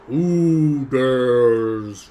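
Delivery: very slow, booming giant demanding who dares; angry giant. Acted. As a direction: draw out every word like a huge threatened giant. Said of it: "You hear in Giant".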